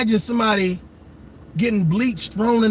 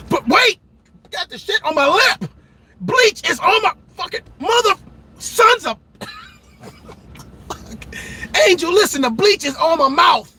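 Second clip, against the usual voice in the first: mock panic; 5 semitones higher than usual. Acting bleach panic.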